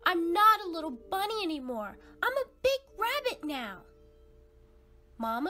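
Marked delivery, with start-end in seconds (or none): 0.0-3.8 high, fast, indignant protest; young rabbit insisting to mom he is big now, high and fast; insistent young rabbit. Acted. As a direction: insist you're all grown up, quickly and higher. Kid insists he's grown up.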